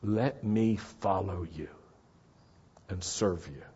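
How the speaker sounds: hushed surrender, sinking at the end; humble prayer. Acted. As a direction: pray it quietly, phrase by phrase.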